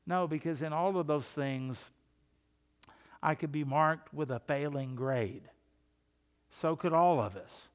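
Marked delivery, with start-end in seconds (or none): none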